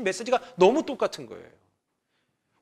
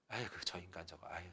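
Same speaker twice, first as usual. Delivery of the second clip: a touch of a fond sigh, then a loud affectionate scolding; God's fond exasperation; 7 semitones lower, 11 dB louder than usual. Acted. Pastor voices God scolding his children, mock exasperated. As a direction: play God grumbling lovingly at a wayward child. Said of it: "이게 하나님 마음이에요".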